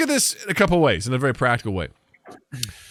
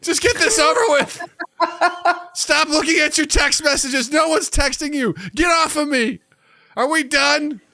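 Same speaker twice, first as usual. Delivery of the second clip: nagging toilet; 13 semitones higher than usual. Pretend. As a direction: yell at them to hurry up. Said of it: "It's like".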